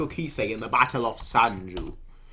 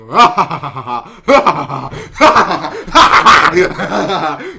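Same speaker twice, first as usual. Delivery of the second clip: long, loud, high maniacal cackle; maniacal laugh; 3 semitones higher, 12 dB louder than usual. Retold or imitated. Imitating Kanki laughing as the soldiers burn, maniacal cackle. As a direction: cackle like a madman watching the fire. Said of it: "And he's just laughing".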